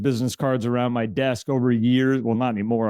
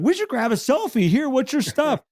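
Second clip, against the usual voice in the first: much higher, pushy cheerful ask; mock eager stranger ask; 10 semitones higher than usual. Pestering a store stranger for a selfie, mock eager and much higher. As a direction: bounce up to a stranger with it. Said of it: "and be like".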